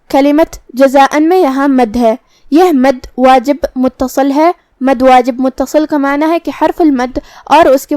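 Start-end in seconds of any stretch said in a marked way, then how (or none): none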